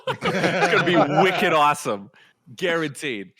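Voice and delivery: put-on loud, laughing Boston accent. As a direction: go full wicked Boston. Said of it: "the Boston accent".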